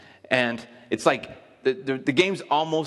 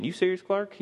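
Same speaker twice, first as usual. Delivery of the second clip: high-pitched, incredulous movie quote; disbelieving question, pitched well up; Christmas Vacation quote; 7 semitones higher than usual. Retold or imitated. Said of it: "you ever seen Christmas Vacation".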